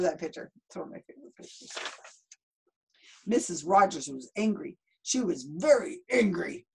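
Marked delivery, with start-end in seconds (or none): 0.7-1.7 fast, soft whisper. Real, not acted. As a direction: whisper it quickly. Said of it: "repeated as whisper".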